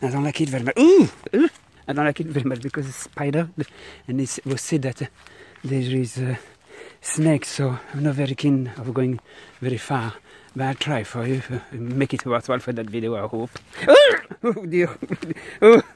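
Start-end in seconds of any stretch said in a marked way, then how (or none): 0.8-1.5 sudden high frightened cry; startled yelp. Real, not acted. Startled in a creepy place. 13.9-15.8 high, loud, slow cry of dismay; frightened cry. Real, not acted. Creepy place full of spiders.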